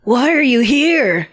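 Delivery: high, drawn-out question; high, slow put-on child voice; child questioning ghost. Reader acts question to ghost.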